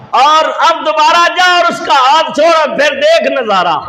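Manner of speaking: ringing, forceful dare; commanding challenge. Acted. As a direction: voice the order from the unseen, pitched high. Voices the unseen voice's challenge.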